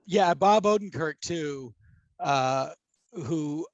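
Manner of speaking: ordinary talk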